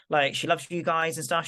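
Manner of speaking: ordinary talk, nothing marked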